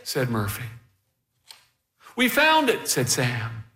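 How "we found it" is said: put-on high, eager young voice; lightly voiced child piping up in discovery; excited kid. A narrator's reading. Kid announcing the hive is found, excited.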